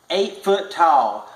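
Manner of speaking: usual speaking voice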